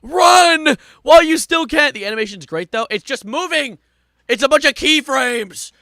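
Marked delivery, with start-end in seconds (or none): 0.0-1.9 slow booming warning; ominous warning shout. Retold or imitated. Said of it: "I'd be like". 2.9-5.7 loud high ranting; exasperated rant. Real, not acted. Ranting about the animation.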